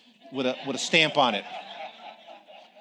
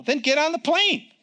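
high, slow and louder command; commanding God; 10 semitones higher, 3 dB louder than usual. Retold or imitated. God telling the preacher to board, commanding. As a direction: order him aboard. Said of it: "God's voice again".

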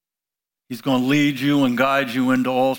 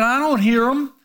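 put-on higher, mouthy young girl talking back; sassy teen retort; 9 semitones higher than usual. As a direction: toss it off like a fresh teenager. Leanne talking back again.

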